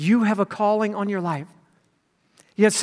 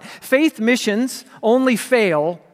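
slow emphatic statement; much slower than usual. Shown as a demonstration. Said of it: "slow emphatic maxim".

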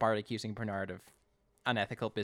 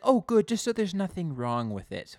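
snobby customer; 7 semitones higher, 8 dB louder than usual. Retold or imitated. Acting Connie's snobby reply.

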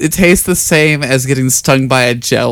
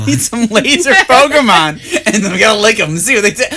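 laughing; 8 semitones higher, 4 dB louder, faster than usual. Real, not acted. Giddy laugh at laser Pokemon.

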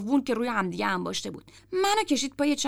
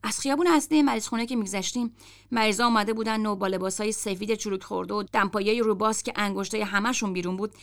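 plain reading voice

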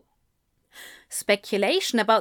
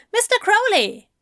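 journalist voice; 12 semitones higher, 5 dB louder than usual. A narrator's reading.

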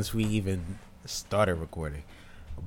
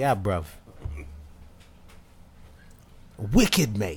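a light put-on London slang accent; mock London accent; slower than usual. Co-hosts joking about a UK rapper, lightly mock London accent. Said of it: "throw a little accent in there".

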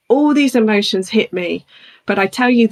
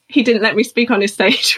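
ordinary talk

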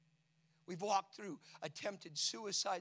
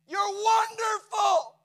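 full-force preaching; 12 semitones higher, 18 dB louder, slower than usual. Acted.

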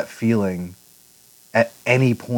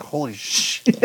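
stunned; 4 semitones higher, much slower than usual. Retold or imitated. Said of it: "I was just like".

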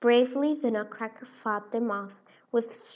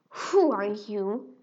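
menacing king; 3 dB louder, slower than usual. Acted. King Rat turns round.

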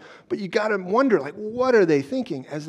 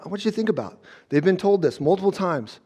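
ordinary delivery

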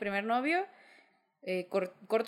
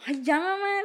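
high, louder teasing protest to mom; mock whine; 9 semitones higher, 4 dB louder than usual. Daughter protesting to her mother, high mock whine. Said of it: "le dije".

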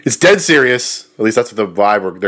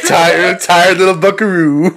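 laughing; 6 semitones higher, 3 dB louder, slower than usual. Real, not acted. Laughing joke.

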